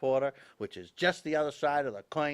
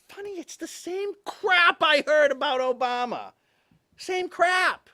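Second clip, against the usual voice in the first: higher, louder exasperated rant; exasperated outburst; 12 semitones higher, 5 dB louder than usual. Real, not acted. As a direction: throw up your hands and vent. Exasperated rant on Obama parallel.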